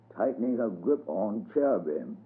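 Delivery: usual speaking voice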